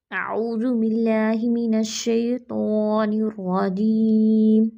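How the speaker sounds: very slow, drawn-out tajweed chant; solemn recitation; Quran recitation. Shown as a demonstration. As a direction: intone each syllable slowly. Recitation opening.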